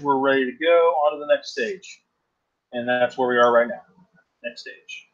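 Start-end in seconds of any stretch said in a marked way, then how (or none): 4.4-5.1 quiet whispered aside. Real, not acted. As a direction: whisper it to yourself.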